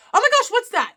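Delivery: high, loud burst of surprise; surprised. Real, not acted. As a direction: react with real astonishment. Meets an unknown term, reacts.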